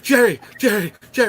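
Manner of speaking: high, loud frantic calling of a name; retold screaming. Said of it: "I just hear screaming".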